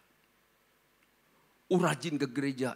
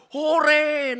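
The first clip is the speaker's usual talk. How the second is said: mock cheer; 12 semitones higher, 11 dB louder than usual. Mock gossip victim cheering.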